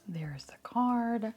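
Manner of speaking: whisper. Real, not acted. Reveals card.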